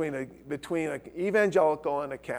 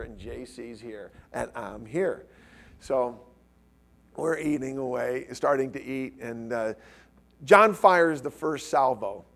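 ordinary talk, nothing marked

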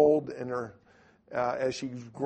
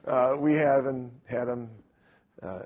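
ordinary delivery

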